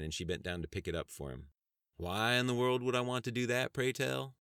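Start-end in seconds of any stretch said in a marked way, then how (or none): 2.0-4.4 scoffing. Acted. Sheriff scoffs at secretary's plea.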